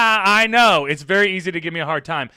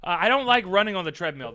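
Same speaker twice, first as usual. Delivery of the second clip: ordinary delivery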